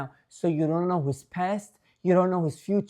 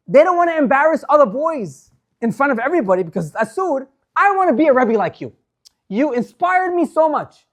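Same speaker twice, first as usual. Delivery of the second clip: higher, louder impassioned retelling; rising fervent sermon voice; preaching at full force; 6 semitones higher, 6 dB louder than usual. Acted.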